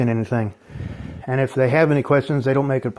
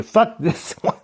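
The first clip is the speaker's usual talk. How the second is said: laughing curse; 9 semitones higher, slower than usual. Real, not acted. Laughing curse mid-rant.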